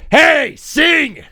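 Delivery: loud, high scolding shout; mock-angry coach's holler; mad coach. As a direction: yell at the team like an annoyed coach. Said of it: "your coach getting mad at you".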